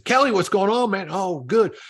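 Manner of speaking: put-on high, loud hearty hello to an old friend; excited greeting. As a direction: greet him like you're thrilled. Greeting friend on retold call.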